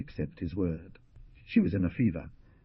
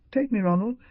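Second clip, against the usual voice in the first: high, louder begging cry; desperate plea; 14 semitones higher, 4 dB louder than usual. A narrator's reading. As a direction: beg him with everything left. Beryl begging Ronnie to elope.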